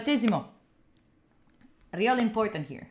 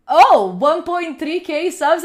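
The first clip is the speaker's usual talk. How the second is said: excited announcement; 4 semitones higher, 6 dB louder, slower than usual. Real, not acted. Announcing a sub goal.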